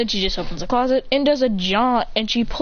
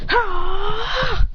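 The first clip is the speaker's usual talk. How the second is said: shout; 10 semitones higher than usual. Acted. Kicks ball into goal.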